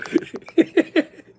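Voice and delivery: high, loud burst of laughter. Real, not acted. Laughing at the Lego joke.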